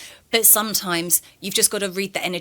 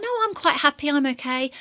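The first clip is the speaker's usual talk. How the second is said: nan refusing; 7 semitones higher than usual. Retold or imitated.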